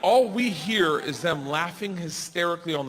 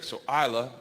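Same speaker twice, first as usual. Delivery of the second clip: usual speaking voice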